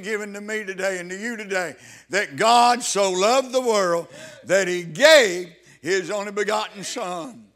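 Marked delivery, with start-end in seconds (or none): 2.1-7.5 louder and slow, each word driven home; fervent preaching. Acted. Preacher hammering the verse home to his church, slow and louder. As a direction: thunder the verse to the pews.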